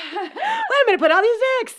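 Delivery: high, laughing put-on voice as the collector; laughing mock founder. Friends joking about the collector, high laughing voice. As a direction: squeal it through a laugh like an overwhelmed collector.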